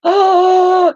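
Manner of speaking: a high, loud gasping oh; starstruck gasp. Retold or imitated. Little girl gasping as the star steps off the bus, loud and high. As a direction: catch your breath at the sight of him.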